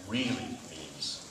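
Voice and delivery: "really" drawn out very slowly, pitched low; stress on "really". Real, not acted. Speaker telling students what dedication really means, "really" slow and emphatic. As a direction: lean on "really" by stretching it out. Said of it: "I emphasize the word really".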